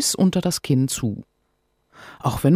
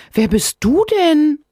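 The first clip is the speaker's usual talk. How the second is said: put-on astonished child; 7 dB louder than usual. Sophia astonished.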